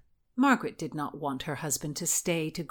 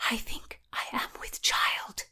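hushed, secretive whisper; 5 semitones higher, 4 dB quieter than usual. A narrator's reading. Anne confides her pregnancy to Margaret, whispering. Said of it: "she whispered at Margaret".

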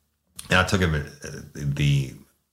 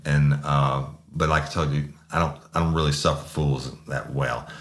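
usual speaking voice

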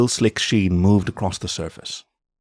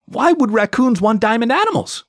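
very high-pitched, incredulous outburst; high, exasperated disbelief; exasperated yelling; 3 dB louder than usual. A narrator's reading. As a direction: cry it out in baffled outrage. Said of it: "he was yelling by the end".

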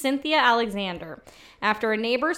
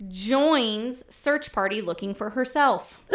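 ordinary delivery

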